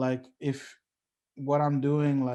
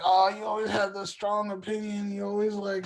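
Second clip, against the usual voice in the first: higher-pitched imitation of critics; mock complaining; 8 semitones higher, 4 dB louder than usual. Friends objecting to his strong opinions, mock complaint. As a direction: mimic people griping, pitched up.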